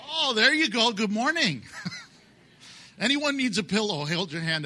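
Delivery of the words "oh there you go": ordinary talk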